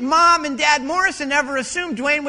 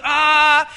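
loud drawn-out mock wail; imitated anguished cry; 2 semitones higher, 7 dB louder than usual. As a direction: cry out as if imitating a heartbroken man. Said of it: "there's that breaking of his heart".

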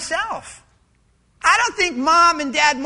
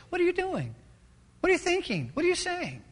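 higher, hushed scolding; urgent rebuke; 5 semitones higher, 6 dB quieter, faster than usual. Acted. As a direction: scold in a raised but softened voice. Acted rebuke from the Spirit.

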